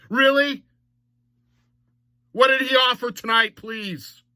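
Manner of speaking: high, incredulous challenge; scornful disbelief. Real, not acted. Host answering comments defending Ryan Reaves, incredulous. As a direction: push the pitch up in exasperation.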